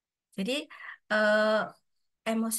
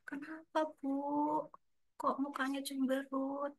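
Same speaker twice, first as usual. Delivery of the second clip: higher, softer voiced coworker, lightly done; soft, higher mock questioner; concerned colleague asking; 4 semitones higher, 9 dB quieter, slower than usual. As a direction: gently ask what's wrong, a touch of playful concern. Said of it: "pas ditanya".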